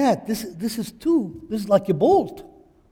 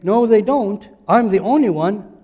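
loud boast; 2 semitones higher, 10 dB louder than usual. Pretend. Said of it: "If I said".

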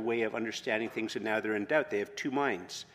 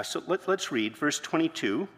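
usual speaking voice

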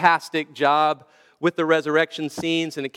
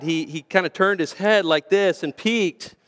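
ordinary talk, nothing marked

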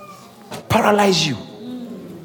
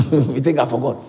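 ordinary talk, nothing marked

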